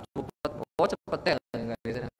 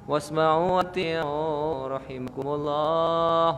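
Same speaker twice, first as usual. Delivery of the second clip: slow, loud khatib chant; sermon opening; 5 dB louder, much slower than usual. Retold or imitated. Preacher mocking a droning khatib, slow and loud. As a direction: intone it like a Friday khatib.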